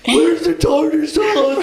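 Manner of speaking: loud, high, drawn-out cartoon cry; Patrick. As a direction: wail it as an over-the-top cartoon character. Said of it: "you do Patrick".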